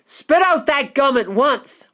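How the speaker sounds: father orders daughter sternly; commanding father; ordering father. Acted. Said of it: "ordered Mister Beauregard".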